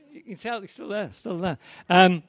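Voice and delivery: ordinary talk, nothing marked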